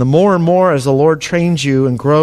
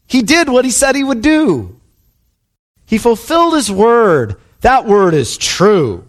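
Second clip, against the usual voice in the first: high, exultant proclamation; raised, triumphant preacher's declaration; voice from the throne; 7 semitones higher, slower than usual. Acted. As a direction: call for praise, then slow down for emphasis. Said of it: "a voice breaks out from the throne and says".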